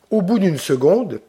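ordinary delivery

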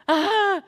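high-pitched mock toddler scream; child's scream. Three-year-old screaming as dad walks in, acted scream. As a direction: scream like a terrified small child. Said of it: "you hear a three-year-old screaming".

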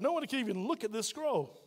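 ordinary talk

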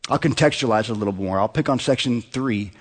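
ordinary talk

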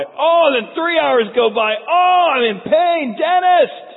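slow, high, loud cry building to a shout; pain returns. Pretend. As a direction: howl for the dentist in mock agony. Pain returns, patient cries out.

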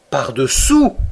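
sudden astonished question; startled cry. A narrator's reading. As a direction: exclaim it as the idea dawns. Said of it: "m'écriai-je".

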